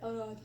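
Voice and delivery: Arad with the R barely there, quiet and soft; swallowed R. Shown as a demonstration. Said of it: "It's, like, not even there".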